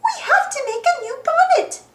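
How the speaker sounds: very high cartoon mouse voice; high-pitched urgent mouse; urgent Minnie. A narrator's reading. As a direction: urge it in a high squeak. Minnie takes urgent charge.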